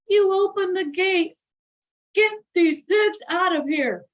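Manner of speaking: loud angry order; mock angry father shout; angry father yell. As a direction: bark it like a fed-up dad. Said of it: "yelled her father".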